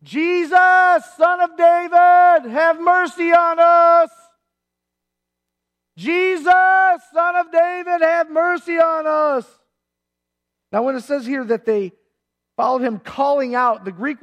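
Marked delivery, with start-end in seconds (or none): 0.0-4.1 high, loud, slow wail of a plea; desperate cry. Retold or imitated. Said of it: "He'd say". 6.0-9.6 high, loud, slow preacher's cry; a fervent shout to Jesus for mercy; Lester Summerall crying out. Retold or imitated. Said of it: "He'd say".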